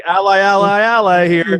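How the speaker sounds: high, hammed-up friendly plea; mock pleading. Canadian assures armed Navy boarders he's friendly, mock pleading.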